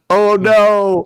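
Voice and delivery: high, loud fake horror; mock dismay. Pretending banishment to a sorority house is awful, mock dismay. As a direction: cry out as if dreading it, badly faked. Feigns dismay at an enviable punishment.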